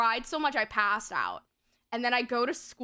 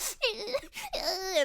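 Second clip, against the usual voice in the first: high-pitched crocodile-tear wailing; put-on whimpering cry; badly faked sobs; fake sobbing; 10 semitones higher than usual. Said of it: "I had to do crocodile tears".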